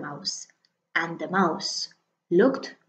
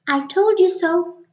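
much higher mouse, bright and quick; little mouse voice; 9 semitones higher, 4 dB louder than usual. Acted. The mouse announcing Snake, high little voice. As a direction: pitch it up like a tiny mouse.

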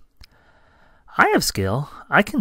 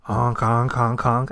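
honking goose imitation; slower than usual. Geese honking at Rudy.

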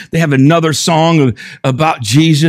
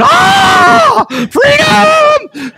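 wife's relief; much slower than usual. Retold or imitated. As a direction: squeal it like peeling off a tight bra. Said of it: "seen your wife after a long day going".

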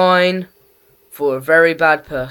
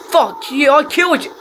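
higher and louder, disbelieving; outraged yell; 10 semitones higher, 5 dB louder than usual. Real, not acted. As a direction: shout at the unfair screen. Gamer outraged at missed kill.

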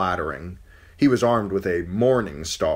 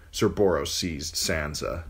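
plain reading voice

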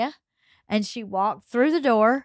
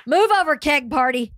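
higher, playful mock announcement; party-crashing mom; 5 semitones higher than usual. Mom crashing daughter's keg party on Mother's Day, playfully rowdy.

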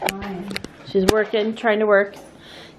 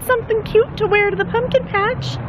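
slight, accidental southern accent, pitched higher; a touch of southern twang; 10 semitones higher than usual. Real, not acted. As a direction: slip lightly into a higher southern lilt. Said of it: "I got a southern accent".

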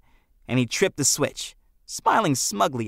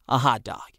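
usual speaking voice

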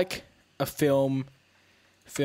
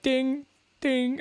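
high-pitched imitated phone ding; ping it like an alert tone; notification chime; 11 semitones higher than usual. Speaker mimics gig refund alerts, high chiming dings.

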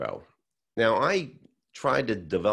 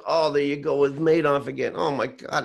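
higher, exasperated brush-off; mock exasperation, pitched up; exasperated retort; 8 semitones higher, 4 dB louder than usual. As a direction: imitate a friend fed up with the subject. Friend brushes off repeated fraud warning.

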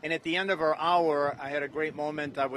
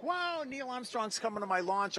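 retelling a starstruck wow, pitched up; mock-thrilled young astronaut; reliving the thrill, voice lifting high; excited; 7 semitones higher than usual. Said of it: "I was so excited".

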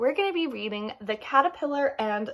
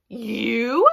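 loud, astonished rising "You?"; a caterpillar taken aback, voice swooping up; surprised; 6 dB louder than usual. A narrator's reading. Caterpillar hears the pollywog will change too, surprised. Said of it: "The caterpillar was so surprised".

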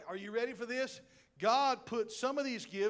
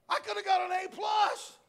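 shout; 8 semitones higher, 6 dB louder than usual. Real, not acted. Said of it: "exclaims about his grade".

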